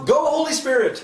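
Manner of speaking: high, loud cheering shout; cheerleader. Pretend. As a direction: cheer it on like a pep rally. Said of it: "Can I be a cheerleader for the Holy Spirit?".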